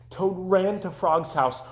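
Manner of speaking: usual speaking voice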